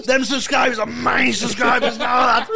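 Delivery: high, loud retold shout; mock screaming match. Podcaster imitating a screaming match over subscribers, mock shouting. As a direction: shout it like a petty squabble. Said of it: "have fucking screaming match".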